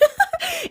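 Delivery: high breathy burst of laughter; late laugh. Real, not acted. Host laughing at her own late laughter, high and breathy. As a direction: break into a high airy laugh. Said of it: "That laughter was late as hell".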